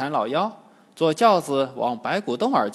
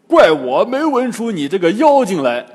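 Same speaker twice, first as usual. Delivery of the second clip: loud, raised scolding voice; Bajie's scorn; 4 semitones higher, 10 dB louder than usual. A narrator's reading. Bajie rebuking the disguised demon, loud scorn. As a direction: spit it out at full volume. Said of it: "八戒呸了一声".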